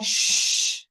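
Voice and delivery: long sharp shh; a drawn-out hiss for silence; shushing. Real, not acted. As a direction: hush the class. Hushing noisy pupils.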